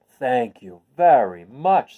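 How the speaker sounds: drawn-out relieved thanks; slow relieved scarecrow; grateful scarecrow. Acted. Scarecrow voiced.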